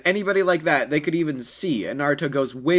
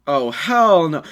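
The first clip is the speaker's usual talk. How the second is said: louder, exasperated outburst; annoyed refusal; 3 semitones higher, 8 dB louder, slower than usual. A narrator's reading. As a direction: shut her down hard and loud. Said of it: "so angry at this".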